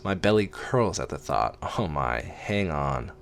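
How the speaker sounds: plain reading voice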